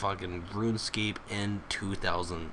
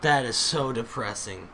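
normal talking voice

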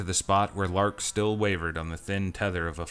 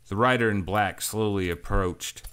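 plain reading voice

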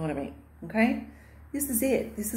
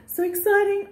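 excited gasp; 12 semitones higher than usual. Real, not acted. Gasp over her tea.

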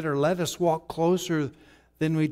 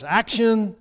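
a raised call to start the take; director's holler; 5 semitones higher than usual. Acted. Director hollering action to start the take, raised call. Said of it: "director hollers".

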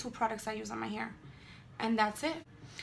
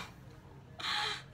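soft breathy laugh; 6 dB quieter than usual. Real, not acted. Laughs at her own ad voice.

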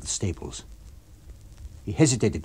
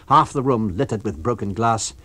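usual speaking voice

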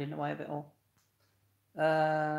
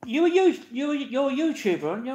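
put-on higher, louder kid voice, a bit breathless; lightly voiced young lad recognising someone; imitating a teen lad; 9 semitones higher, 5 dB louder than usual. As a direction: play the excited boy spotting a YouTuber. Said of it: "and he said".

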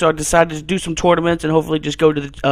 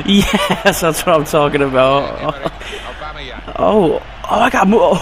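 laughing cheer; 5 semitones lower than usual. Real, not acted. Laughing after scoring.

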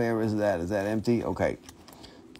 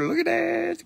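squeal of delight. Acted. Squealing over a crystal.

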